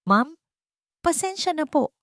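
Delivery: high, rushed, panicky sorry; a waiter who fears for his job; flustered apology. Acted. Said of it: "sabi ng natatarantang waiter".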